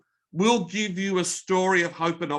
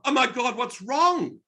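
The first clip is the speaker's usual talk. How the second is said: mock alarmed concern; 8 semitones higher than usual. Mock concern at glum reply.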